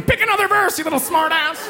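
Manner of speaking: high, shrill-ish scolding yell; mock angry mother. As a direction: scold like a furious mom chasing a kid. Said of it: "chasing me around church going".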